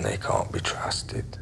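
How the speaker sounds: low, slow, whispered threat; menacing whisper. Acted. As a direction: lean in and whisper the distrust. Menacing confidential aside.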